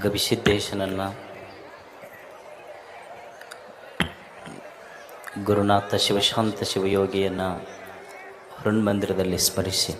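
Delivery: soft, low, devout opening greeting; solemn invocation. Acted. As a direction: call on the guru reverently before the talk.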